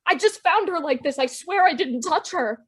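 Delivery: high-pitched frantic denial; panicked protest. Acted. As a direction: plead innocence in a panic. Suspected at murder scene, protesting.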